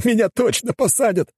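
high-pitched mild wail; panicked wail. A narrator's reading. Man fears he will be jailed, high wail. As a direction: wail it high, without real loudness. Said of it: "завопил тот".

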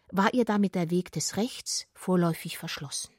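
ordinary delivery

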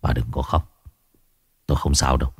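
soft, quiet, calm comfort; gentle reassurance. Acted. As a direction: soothe a crying wife. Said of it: "giọng ông trở lại bình thường".

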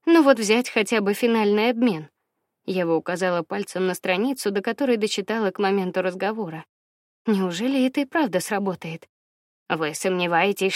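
usual speaking voice

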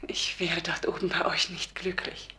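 quiet whispered refusal; soft whisper. Acted. Dead Harriet whispers her refusal to the archangels. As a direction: whisper it gently to the angels.

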